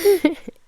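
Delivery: sudden high laugh; burst of laughter. Real, not acted. New mom laughing at her own grocery store rage, high burst.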